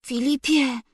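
a sick mother's faint call; weak voice. A narrator's reading. Sick mother calling her sleeping husband, weak and faint. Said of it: "rzekła słabym głosem".

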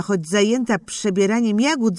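ordinary delivery, nothing marked

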